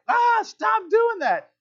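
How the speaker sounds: high, drawn-out mock scream; little brother's scream. Little brother pushed out to sea, high mock scream. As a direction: imitate a kid squealing in protest. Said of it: "And I scream, like a good little brother".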